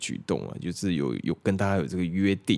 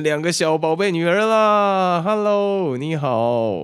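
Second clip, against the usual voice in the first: excited greeting; 11 semitones higher, 6 dB louder, slower than usual. Real, not acted. Greeting a friend.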